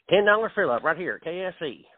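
mock KFC voiceover. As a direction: sell it like the commercial. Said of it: "It does sound like him".

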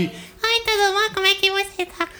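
tiny, very high-pitched cartoon-kid voice; Michelle's cutesy voice. Friend showing off Michelle's cute little voice, squeaky baby-talk. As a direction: squeak it like a children's dubbing actor. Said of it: "a vozinha dela ... dubladora infantil ... Vozinha de".

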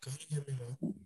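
faint, far from the phone; distant phone voice; barely audible. Real, not acted. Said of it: "Are you far from your phone?".

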